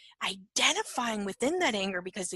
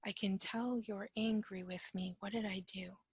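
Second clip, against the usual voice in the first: softer, at normal pitch; quiet repeat; 6 dB quieter than usual. Shown as a demonstration. Teacher replays the companion's words for reflection, quiet and unacted. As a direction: repeat it softly and reflectively, without acting. Repeats the quote slowly to examine it.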